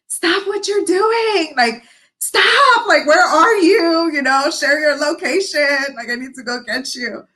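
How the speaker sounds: frantic, near tears, begging her to stop; panicked friend. Retold or imitated. Friend begging her not to quit, high panicked plea. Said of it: "Like, that was my friend".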